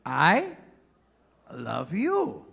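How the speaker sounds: "I", pause, "love you" with swooping tune; romantic tone. Shown as a demonstration. Comic showing the proper tone, slow, romantic swoops. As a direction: glide up on "I", then let "love you" fall. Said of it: "Nadanya harus".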